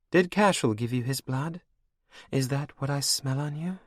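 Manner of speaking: high, cold and mocking; villainess queen. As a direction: purr it over your captive like a cruel queen. Anne taunts Olivia.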